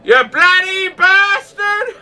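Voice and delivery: high, drawn-out loud yell. Retold or imitated. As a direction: bellow it as loud as you can, stretching it out. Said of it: "yelled out as loud as I could".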